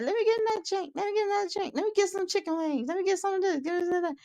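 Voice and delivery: high, bossy ordering voice; greedy customer voice. Retold or imitated. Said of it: "she like".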